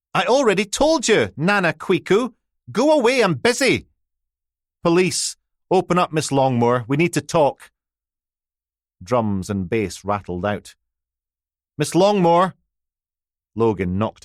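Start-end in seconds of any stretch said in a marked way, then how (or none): 0.1-3.9 put-on high, loud teenage girl lashing out; snapping out. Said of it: "A female voice slashed out". 4.8-7.7 1 calling out. Acted. Officer calls through door. 11.8-12.5 1 louder call. Acted. Officer calls louder through door.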